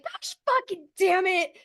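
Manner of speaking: stranded again, cursing in exasperation; exasperated curse. Retold or imitated. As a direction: throw up your hands and curse. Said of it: "Literally just like".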